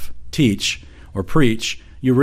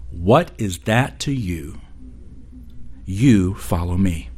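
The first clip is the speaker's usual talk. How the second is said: solemn quote; 4 semitones lower, 4 dB quieter, slower than usual. Retold or imitated. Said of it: "And I quote".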